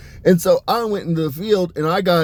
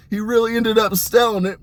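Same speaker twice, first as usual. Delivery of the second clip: ordinary talk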